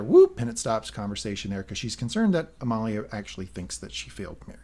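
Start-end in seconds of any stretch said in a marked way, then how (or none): 0.0-0.4 sharp high whoop marking a cut; cartoon scene-cut whoop; high whoop effect. Real, not acted. Host marking a scene cut, high whoop.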